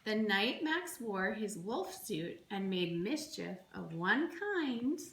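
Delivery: plain reading voice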